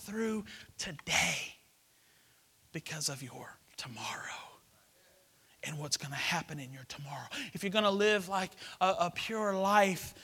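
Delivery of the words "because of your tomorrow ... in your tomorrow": whispering. Acted. Whispered preaching.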